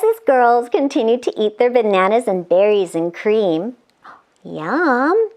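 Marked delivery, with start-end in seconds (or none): none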